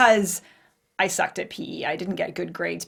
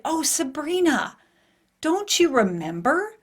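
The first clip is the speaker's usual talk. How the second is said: lightly voiced sweet mother, slow and high; a gentle old mom reminding her daughter, drawn out; mock sweet mother, slow; 6 semitones higher than usual.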